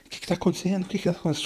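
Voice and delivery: a man startled awake from possession; frightened man. Retold or imitated. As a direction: ask it scared and confused.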